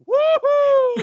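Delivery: loud, high joyful whoop; excited whoop. Real, not acted. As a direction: let out a big happy cheer. Cheering the King's return.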